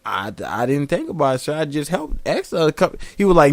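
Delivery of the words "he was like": usual speaking voice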